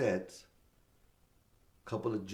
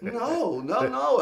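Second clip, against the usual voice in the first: high, giggling refusals; playful laughing no's; 13 semitones higher, 6 dB louder, much slower than usual. Retold or imitated. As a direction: tease while dodging his grab.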